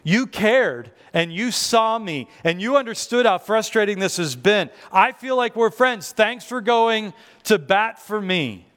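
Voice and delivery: effusive mock-earnest thank-you; gushing gratitude. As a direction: pour out thanks with emphasis. Gushing thanks retold to the rep.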